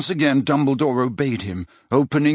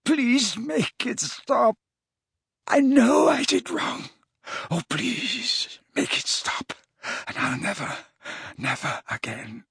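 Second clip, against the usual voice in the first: high wail fading to a weak whimper; broken, guilt-ridden begging; sobbing plea; 11 semitones higher, slower than usual. A narrator's reading. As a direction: beg tearfully, sinking to near voiceless. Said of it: "he sobbed".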